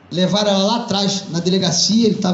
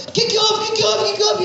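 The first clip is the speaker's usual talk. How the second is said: alarmed police questioning; 13 semitones higher than usual. Acted. Police rush in.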